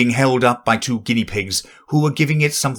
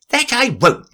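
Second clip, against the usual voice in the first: high refusal; 3 dB louder than usual. Acted. Worker refusing.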